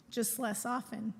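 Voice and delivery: ordinary talk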